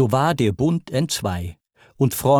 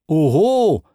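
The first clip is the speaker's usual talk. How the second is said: sharp, indignant outburst, louder; narrated god shouting in fury; angry shout; 5 semitones higher, 4 dB louder than usual. A narrator's reading. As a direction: fling it at him in anger. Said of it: "schrie ihm Hermes zornig zu".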